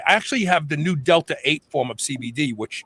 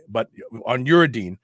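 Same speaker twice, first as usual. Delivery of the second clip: ordinary talk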